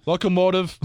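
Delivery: a hint of a Russian accent, laughing; joking Russian accent on a team name; mock Russian accent. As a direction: say it like a hammed-up Russian, breaking into a laugh. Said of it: "I'll do my Russian accent".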